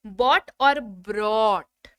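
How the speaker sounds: very slow, each word set apart: बॉट then ब्रॉट with the r; bought versus brought. Shown as a demonstration. Teacher contrasting two confusing words for students, slow and clear. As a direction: drag out बॉट और ब्रॉट to show the difference. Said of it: "उच्चारण जो है वो confusion होता है".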